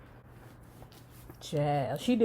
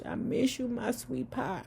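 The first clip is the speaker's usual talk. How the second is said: mock tender; 4 semitones higher, 7 dB quieter than usual. Mock tender farewell to Harry.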